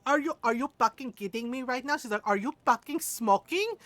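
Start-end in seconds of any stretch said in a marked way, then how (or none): 0.1-2.0 put-on loud, incredulous Filipino accent; Filipino mother. Filipino mom confronting her son, loud accented disbelief. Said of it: "our little tiny Filipino mother". 2.3-3.7 put-on louder Filipino-accented accusation; Filipino mother. Filipino mom catching her son smoking, accented outrage. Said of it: "our little tiny Filipino mother".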